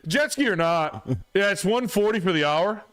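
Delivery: high-pitched mock beach-bum voice. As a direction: put on a very high voice for a washed-up jet ski seller.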